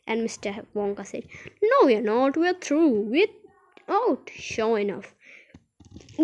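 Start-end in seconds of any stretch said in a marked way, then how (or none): 1.6-4.3 loud, rising jubilant bursts; triumphant announcement. A narrator's reading. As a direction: proclaim the escape in short bursts. Wonka triumphant as lift breaks through.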